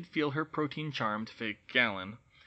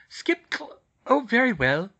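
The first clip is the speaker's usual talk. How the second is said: indignant huff; 9 semitones higher, 8 dB louder, slower than usual. Acted. Hermione indignant then relents.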